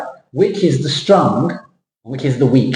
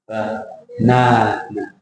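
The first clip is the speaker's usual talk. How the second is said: Banana stretched very slowly, lower and softer; slow stressed banana; 4 semitones lower, 4 dB quieter, much slower than usual. Shown as a demonstration. Teacher demonstrates slow banana for the student. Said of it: "Can you do it for me".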